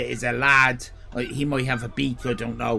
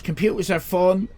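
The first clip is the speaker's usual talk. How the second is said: ordinary talk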